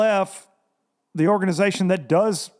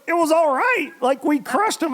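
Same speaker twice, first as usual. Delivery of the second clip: higher, amazed echo of "all right"; incredulous echo; 10 semitones higher than usual. Retold or imitated. Guest can't believe the trip was only all right, incredulous. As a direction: throw "It was all right" back in disbelief. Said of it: "And he goes".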